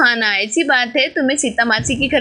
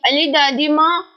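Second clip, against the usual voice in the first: normal talking voice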